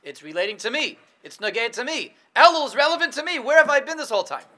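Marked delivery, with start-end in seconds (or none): 2.4-4.4 sudden realization, loud and high; excited. Acted. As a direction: exclaim it like a revelation. Dramatic realization, excited exclamation.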